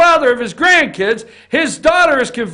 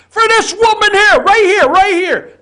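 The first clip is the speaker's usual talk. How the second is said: heated rant; 8 semitones higher, 6 dB louder than usual. Real, not acted. Host ranting about the rules.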